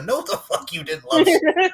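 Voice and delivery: high, loud, laughing through the words; laughing outrage. Real, not acted. Podcast host laughing at the killer's claim of love, high and loud. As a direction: cackle it out in disbelief.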